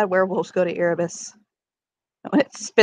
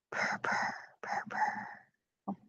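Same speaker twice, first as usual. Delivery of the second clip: soft whispered cat purr; purring lazy cat; 19 dB quieter, much slower than usual. Pretend.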